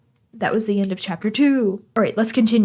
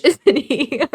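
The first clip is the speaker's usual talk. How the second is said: laughing; 3 semitones higher, 5 dB louder than usual. Real, not acted. Laughing at the British character.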